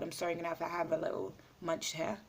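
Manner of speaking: quick aside. Real, not acted. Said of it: "My food is getting cold".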